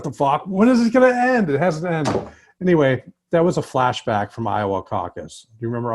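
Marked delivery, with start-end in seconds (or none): 0.0-1.5 exasperated outburst. Retold or imitated. Retelling election-week disbelief, a loud high exasperated cry. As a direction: pitch climbing sharply into a louder, much higher exasperated cry. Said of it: "The next day, I was like".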